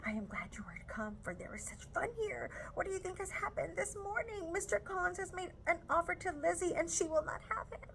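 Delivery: Lydia whispers it, breathless with gossip; hushed, giddy half whisper, rising in pitch. Acted. Lydia whispering gossip to Charlotte, excited half whisper. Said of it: "cried in ha-, a half whisper".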